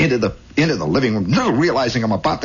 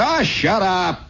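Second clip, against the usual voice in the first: high, scornful big-brother put-down; acted kid's bragging retort; bratty kid; 9 semitones higher than usual. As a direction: sneer at your little brother. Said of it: "I said".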